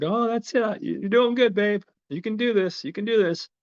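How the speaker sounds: high-pitched, acted cheering-on; eager encouragement. Boyfriend cheers girlfriend picking the handcuff lock, eager encouragement.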